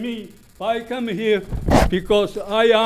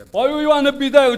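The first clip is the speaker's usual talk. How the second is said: angry; 4 semitones higher, 6 dB louder than usual. Real, not acted. Resident yells at the chairman.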